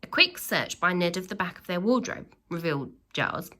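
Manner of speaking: ordinary delivery, nothing marked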